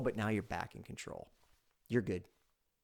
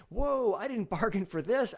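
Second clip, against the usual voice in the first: high, slow, taken-aback protest; flustered expert; 8 semitones higher, 5 dB louder than usual. Acted. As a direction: act out an expert caught off guard. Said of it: "they're gonna get a little flustered".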